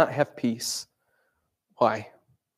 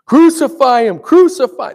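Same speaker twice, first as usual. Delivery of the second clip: much higher, very loud yell; mob shout; 10 semitones higher, 13 dB louder than usual. Retold or imitated. Preacher imagining being in the mob, loud shout. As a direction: scream it as part of the crowd.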